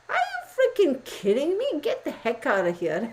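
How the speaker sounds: high incredulous outburst, settling by the end; mock disbelief. Retelling her reaction to the fake accent, high incredulous outburst. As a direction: throw up your hands at it. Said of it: "and I was like".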